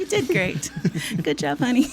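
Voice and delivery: gentle praising therapist, laughing. Retold or imitated. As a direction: soothe like a counselor. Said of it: "That's your therapist voice".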